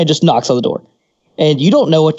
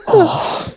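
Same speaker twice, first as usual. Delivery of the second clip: long, breathy sigh of relief; big relieved sigh. Shown as a demonstration. Man telling friends about finishing in the bathroom, big sigh. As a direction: let it all out in a sigh. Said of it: "you do a collective sigh".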